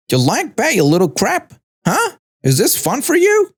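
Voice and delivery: high-pitched, menacing questions; angry taunt. Acted. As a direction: taunt with rising, incredulous pitch. Attacker taunts boy while dunking him.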